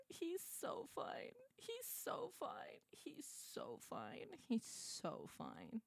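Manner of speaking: whispered, almost no voice; breathless whisper. Real, not acted. Swooning over the game hero, whispered. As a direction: breathe it out in awe. Gushing over a handsome character.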